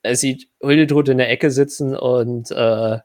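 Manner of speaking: ordinary talk, nothing marked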